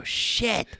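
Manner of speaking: breathy whispered "Shit."; hushed dismayed curse. Retold or imitated. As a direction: mutter it under your breath in dread. Dread on seeing Goose.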